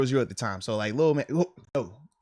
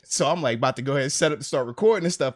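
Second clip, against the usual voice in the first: ordinary delivery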